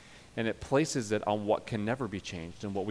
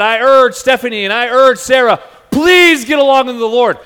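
much louder and higher, hammed-up urging; mock pleading exhortation; 13 semitones higher, 19 dB louder than usual. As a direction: overdo the pastoral plea for comedy. Mock urging of two quarrelling women.

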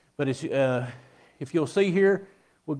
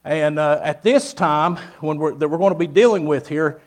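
preaching; 6 dB louder than usual. Acted. Preaching to the congregation.

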